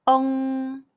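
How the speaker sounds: "ong" held long, the g barely sounded; pinyin eng demo. Shown as a demonstration. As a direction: draw out "ong" on a level nasal ending. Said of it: "the G sound is barely pronounced".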